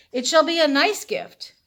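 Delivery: mock proclamation. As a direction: read the sign like a herald. Villagers' sign read out grandly.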